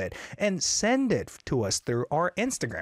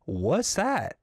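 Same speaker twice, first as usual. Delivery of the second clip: ordinary talk, nothing marked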